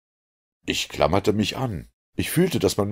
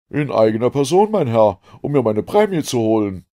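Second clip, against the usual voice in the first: loud, higher-pitched, bold reply; hearty Canadian harpooner; 6 semitones higher, 7 dB louder than usual. A narrator's reading. Ned answers the shipwrecked narrator, hearty loud voice. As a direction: announce yourself with gusto.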